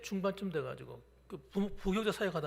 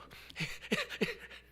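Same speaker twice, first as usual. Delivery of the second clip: a short high unvoiced laugh; high breathy laugh. Real, not acted. Pastor laughing at his runny-nose memory, high breathy laugh. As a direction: laugh up at your own story.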